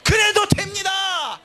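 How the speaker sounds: high, loud, drawn-out shout; slow, emphatic pulpit shout; defiant proclamation. Acted. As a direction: cry it out against all doubt. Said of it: "이거를 외치는 게 목사라는 거예요".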